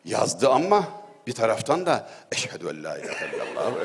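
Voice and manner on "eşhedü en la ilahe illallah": mock frightened prayer. Frightened caller mutters the shahada.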